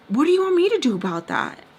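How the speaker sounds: loud, high retold snap; exasperated retort. Answering her plea for mom, exasperated retold retort.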